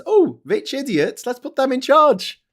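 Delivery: high, loud, drawn-out parody voice; mock gullible public. Voters choosing rich idiots as leaders, mock delight. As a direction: cheer it with hammed-up daft enthusiasm.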